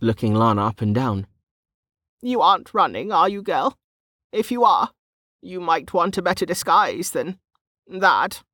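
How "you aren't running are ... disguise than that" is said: put-on high-pitched old market woman, probing; teasing old woman.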